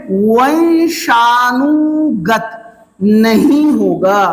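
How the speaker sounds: वंशानुगत नहीं होगा drawn out slowly, each word weighted; slow emphatic stress. Shown as a demonstration. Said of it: "teacher stresses the key term". (धीमे और ज़ोर देकर समझाना)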